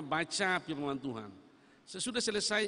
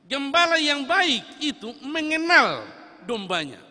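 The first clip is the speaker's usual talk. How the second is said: loud, high and slow, each word weighed; solemn ringing proclamation; slow emphatic preaching; 9 semitones higher, 13 dB louder than usual. Acted. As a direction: declare it like a creed.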